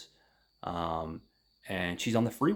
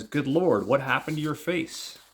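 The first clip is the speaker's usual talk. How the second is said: higher, louder startled exclamation; retold shock; 7 semitones higher, 6 dB louder than usual. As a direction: blurt it out, acting taken aback. Retold driver startled by scar.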